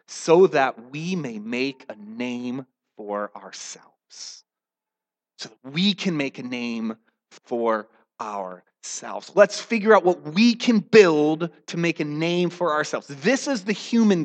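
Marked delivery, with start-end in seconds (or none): 0.1-4.4 slow emphasis. Real, not acted. Reads scripture slowly, drawn out. 5.4-9.3 slow, weighty repeat. Real, not acted. Repeats phrase slowly for weight.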